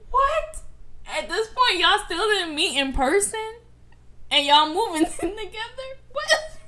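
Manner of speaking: high, loud and incredulous; can't believe what she's hearing; stunned disbelief. Real, not acted. Cohost reacting to the story, loud and incredulous.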